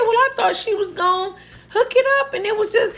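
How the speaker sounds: whiny high excuse. Retold or imitated. Arguing back to the scolding manager, whiny high excuse. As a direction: rush a squeaky self-defence. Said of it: "I said".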